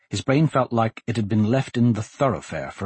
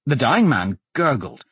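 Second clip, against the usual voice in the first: ordinary delivery, nothing marked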